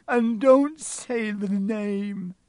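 high, loud, slow tearful plea; sobbing. A narrator's reading. Hagrid begs Harry not to name Voldemort, sobbing. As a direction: plead through acted sobs. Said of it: "sobbed Hagrid".

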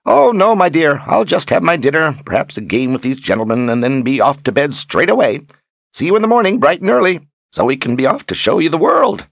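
put-on high, lilting, cheery old-rogue act; Zed, breezily dismissive. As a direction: brush her off with airy good cheer.

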